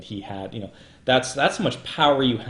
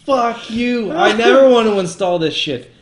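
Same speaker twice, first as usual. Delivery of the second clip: higher and louder, a furious rant at the software; angry outburst; 6 semitones higher, 7 dB louder than usual. Retold or imitated. Ranting podcaster fed up with iTunes prompts, angry shout. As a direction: shout it down like the computer can hear you. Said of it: "And I'm like".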